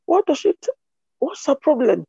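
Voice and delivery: sudden high, loud outrage; as if she can't believe what she just heard; a housemate's shocked "what's her problem"; indignant disbelief. Retold or imitated. Friend outraged on hearing story.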